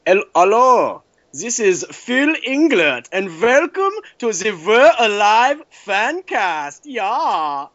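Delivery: put-on high, slow German accent. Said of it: "I'll do it in a German accent".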